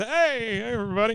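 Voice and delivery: high, goofy friendly greeting; Bill waving at the speaker. Retold or imitated. Bill greets the crowd through a PA speaker: high goofy hello. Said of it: "He's just".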